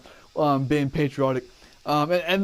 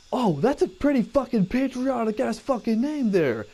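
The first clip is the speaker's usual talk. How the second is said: drill sergeant; 9 semitones higher than usual. Retold or imitated. Drill sergeant yelling at recruit.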